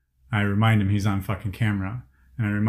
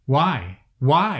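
indignant why; 5 semitones higher, 5 dB louder than usual. Real, not acted. Venting anger about bystanders.

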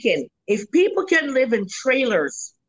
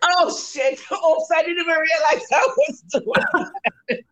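embarrassed laugh; 7 semitones higher than usual. Real, not acted. Caught out, laughing.

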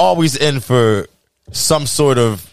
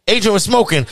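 normal talking voice

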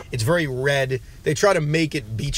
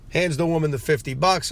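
ordinary delivery

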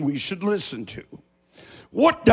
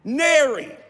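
Appalachian word, high; 7 semitones higher than usual. Shown as a demonstration. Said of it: "nary is not a name".

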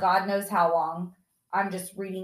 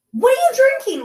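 "What are you drinking?" shouted much higher and louder; high alarmed yell on "are"; retold yell; 14 semitones higher, 9 dB louder, much faster than usual. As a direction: demand it like a shocked parent. Mom yelling at drunk son.

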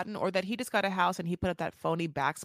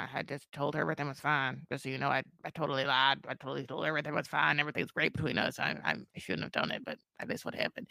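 low, quiet mumbled admission; imitating a subdued confession; retold confession; 7 semitones lower, 6 dB quieter, faster than usual. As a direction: own up under your breath, trailing away. Retold sheepish confession to partner.

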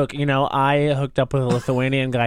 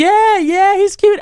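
squeaky, eager yes; high excited voice; 8 dB louder, slower than usual. Retold or imitated. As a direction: gush it high and loud. Friend answers, imitated.

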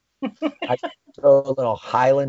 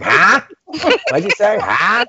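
loud, high, baffled "Huh?"; hammed-up incomprehension, pitched up; clueless passenger; 7 semitones higher, 6 dB louder than usual. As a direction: play a dork who didn't understand. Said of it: "And they're like".

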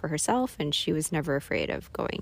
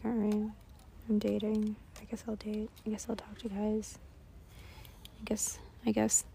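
resigned whisper; 2 semitones higher, 9 dB quieter than usual. Retold or imitated.

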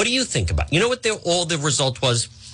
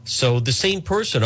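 ordinary talk, nothing marked

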